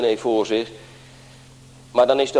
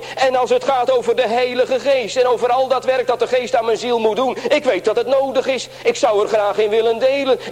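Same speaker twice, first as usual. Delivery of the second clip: much higher, plaintive voice of an unworthy soul; imitating a lamenting believer, much higher; self-doubting churchgoer; 10 semitones higher than usual. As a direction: pitch up and plead as the doubter. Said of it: "Dat er mensen zijn die zeggen".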